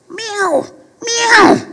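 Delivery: very high cat meow, loud on the second. Acted. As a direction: squeal out a cat's meow. Cat appears in story.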